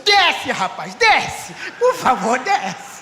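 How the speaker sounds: loud, exasperated command to get out; retold exasperated order. Pastor ordering passenger out of the car, loud exasperation. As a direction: bark the order, then plead loudly.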